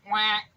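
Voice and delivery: sharp loud quack. Acted. Duck quacking at the piglet. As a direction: quack it once.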